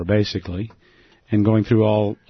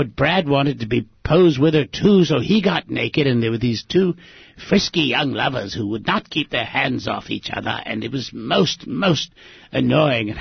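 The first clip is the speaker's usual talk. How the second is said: Don impression; 3 semitones higher than usual. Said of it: "I'll try to do my Don impression".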